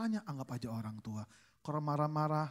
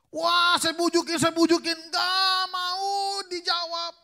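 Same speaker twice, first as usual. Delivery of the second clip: exasperated pleading; 13 semitones higher, 11 dB louder, much slower than usual. Retold or imitated. Husband pleads with sulking wife.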